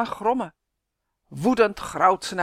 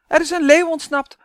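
loud urgent shout; calling out; 9 semitones higher, 10 dB louder than usual. Acted. Man warning Pet about escaped lion, loud call. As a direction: yell the warning across the zoo. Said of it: "roept hij naar Pet".

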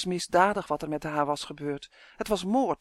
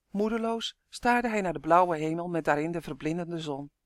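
plain reading voice

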